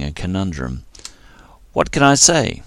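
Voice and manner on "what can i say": wry shrug. A narrator's reading. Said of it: "said with a small smile".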